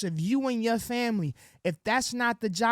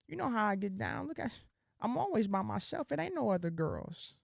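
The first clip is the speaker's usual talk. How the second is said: mock sweet-talk, much softer murmur; sweet nothings; 8 dB quieter than usual. Imitating his old self sweet-talking women, soft murmur. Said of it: "Sweet nothings".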